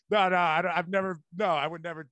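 usual speaking voice

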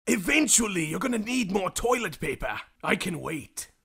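low, quiet menacing whisper; creepy villain voice. Acted. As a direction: lurk and threaten softly. Creepy Tony Thumbpoke reply.

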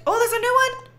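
high bright burst of delight; thrilled at spotting something new; excited. Real, not acted. Spots a new item in game.

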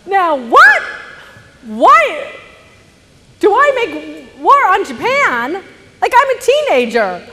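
high, loud incredulous rant; loud high mock-offended outburst; outraged disbelief. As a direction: protest like an indignant teenager. Outraged teen inner rant.

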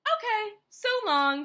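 high, loud cheery goodbye; cheerful. A narrator's reading. Rosie the tumbleweed leaves Hank alone, cheerfully. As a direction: sing out a carefree farewell. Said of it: "said Rosie cheerfully".

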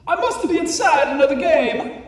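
high, loud put-on Mario voice; Mario worried. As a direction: voice Mario realizing he's trapped. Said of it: "he thought".

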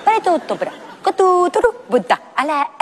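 words breaking down into gibberish; garbled stream of meaningless syllables; nonsense babbling. Pretend. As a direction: babble on after your thoughts run out. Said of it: "seguiría hablando y llegaría un momento".

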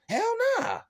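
very high, loud hell-nah shout; scoffing yell. Real, not acted. Vlogger scoffing at staying broke, shrieked. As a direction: shriek the refusal. Scoffing refusal mid-rant.

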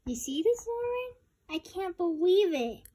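high, loud, indignant outburst; worked-up disbelief. Real, not acted. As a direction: exclaim it high, as if outraged. Upset reaction to a video.

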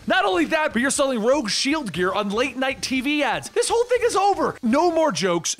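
high, emphatic scolding; exasperated acted rant; angry telling-off. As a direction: lay down the law, pitch climbing. Peter angrily lays down the law.

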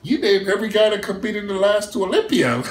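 high laughing jab. Real, not acted. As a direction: laugh at the snub. Laughing at being left out.

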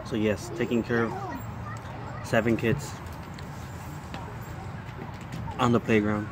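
speaking between bites; talking while chewing. Real, not acted. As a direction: mouth partly full of bread. Seen 0: Holds bread at mouth, bites at 3.5-4.1s, chews while talking.

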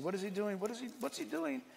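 quiet, puzzled aside; hushed mock bewilderment; baffled media crew murmuring. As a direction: imitate a crew quietly wondering among themselves. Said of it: "the poor media people are saying".

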